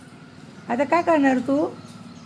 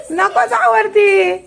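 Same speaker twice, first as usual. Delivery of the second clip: calling out; 8 semitones higher, 5 dB louder, much slower than usual. Real, not acted. Mother stopping toddler climbing up.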